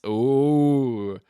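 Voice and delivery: long, loud, rising-falling oooh; scandalized ooh. Pretend. Teasing chat over a hotel rumor, scandalized.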